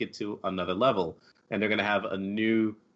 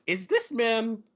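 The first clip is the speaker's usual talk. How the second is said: higher, incredulous question; pitched-up wondering disbelief; mock disbelief; 6 semitones higher than usual. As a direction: act amazed he's really British. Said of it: "I was like".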